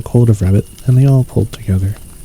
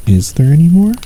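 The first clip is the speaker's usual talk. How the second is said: eager; 7 semitones higher than usual. A narrator's reading. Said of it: "asked Pooh quickly".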